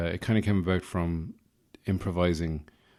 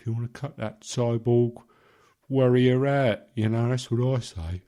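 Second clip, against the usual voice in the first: put-on English geezer accent, slightly raised; 3 semitones higher than usual. Advising someone not to worry, in a put-on English accent. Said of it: "So I was doing those voices".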